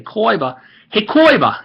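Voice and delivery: high Brooklyn accent, calling out; Joey. Shown as a demonstration. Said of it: "as Joey would say".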